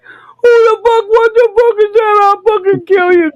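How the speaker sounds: very high, loud, strained furious shout; furious dad yelling. Retold or imitated. Dad, shot at in the outhouse, a high, loud furious yell. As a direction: scream like a dad ambushed in the outhouse.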